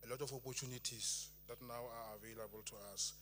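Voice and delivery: normal talking voice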